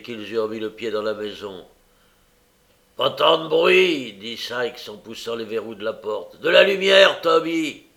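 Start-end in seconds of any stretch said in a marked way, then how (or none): 3.0-4.1 loud, slow, raised rebuke; forceful, drawn-out reprimand; irritated rebuke. A narrator's reading. Burglar rebuking the loud doorman. 6.4-7.8 high, loud call for service; raised, commanding call; called-out order. A narrator's reading. Burglar calling for a light.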